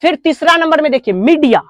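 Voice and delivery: normal talking voice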